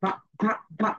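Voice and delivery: ভা repeated with teeth on lip; letter sound va. Shown as a demonstration. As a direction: drill "ভা". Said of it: "It sounds like ভা".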